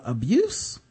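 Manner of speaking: ordinary talk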